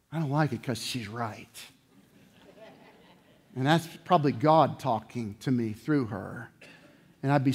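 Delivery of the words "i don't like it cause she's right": sulky mutter. Retold or imitated. Sulking in the corner.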